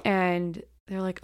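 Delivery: ordinary delivery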